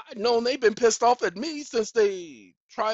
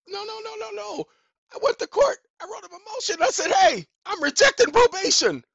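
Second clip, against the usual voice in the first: very high, agitated protest getting louder; worked-up, high-pitched defiance; defiant retelling; 13 semitones higher, 9 dB louder than usual. Retold or imitated. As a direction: build to a loud declaration of refusal.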